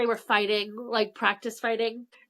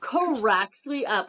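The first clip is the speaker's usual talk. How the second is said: ordinary talk, nothing marked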